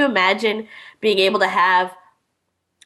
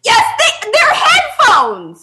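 laughing; 13 semitones higher, 6 dB louder, much slower than usual. Real, not acted. Laughing protest in sibling banter.

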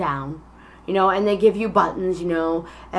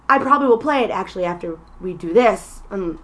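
ordinary delivery

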